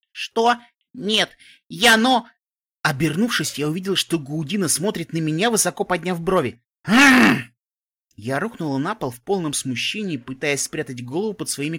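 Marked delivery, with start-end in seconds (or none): 0.1-2.3 flustered. Acted. Embarrassed denial. 6.8-7.4 embarrassed cry. Acted. Collapse in embarrassment.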